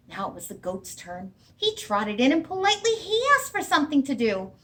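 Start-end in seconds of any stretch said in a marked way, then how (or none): none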